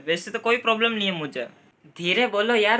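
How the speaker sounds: normal talking voice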